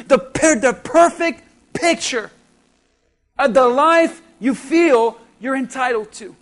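stirring sermon delivery leaning on never; preaching. Acted. Pastor tells the congregation about loss, earnest preaching. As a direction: build the list with rising emphasis.

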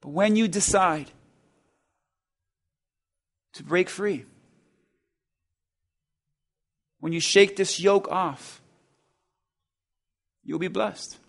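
very soft, low, spaced-out father's words; hushed promise. Acted. Preacher quoting the father to the weeping son, hushed. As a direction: breathe the promise gently. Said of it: "His father said".

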